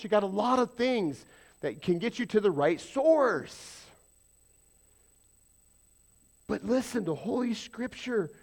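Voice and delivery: loud, high, driving sermon voice; full-force preaching. Real, not acted. Pastor preaching hard to the church, loud and rising. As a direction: preach it out to the congregation.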